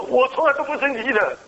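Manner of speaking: high-pitched mock-angry insistence, a second go; angry denial. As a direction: act out the furious doctor's denial, pitched high. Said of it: "他很生气的说". (模仿医生高声生气地否认)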